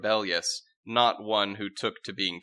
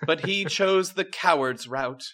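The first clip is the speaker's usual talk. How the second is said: high, loud mock-regal princess voice; haughty princess; 8 semitones higher, 4 dB louder than usual. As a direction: play the rebellious princess grandly. Princess condemns the king.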